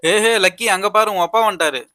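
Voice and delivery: high, loud call to get her attention; hey, look over there. Acted. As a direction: friend alerts the girl her father has arrived, loud and high. Calling the girl's attention.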